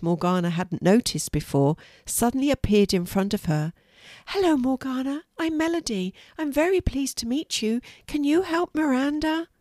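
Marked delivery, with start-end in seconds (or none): 4.3-9.5 put-on high, light girlish voice; young mermaid voice. A narrator's reading. Little mermaid Melody greeting Morgana with a high girlish voice. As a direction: sound young and eager.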